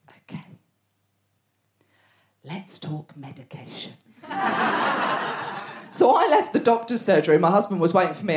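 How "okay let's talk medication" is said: soft unvoiced whisper. Doctor quietly raising medication, hushed whisper. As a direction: say it under your breath.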